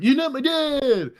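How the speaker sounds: put-on high, slow defiant kid shout; bratty kid line. A kid yelling at the new stepdad, high and defiant. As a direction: whine it like a sulky stepkid. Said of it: "and you're like".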